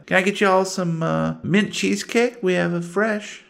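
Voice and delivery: high drawling waitress voice; waitress Wilma. Acted. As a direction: greet them like a folksy old diner waitress. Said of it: "she's like".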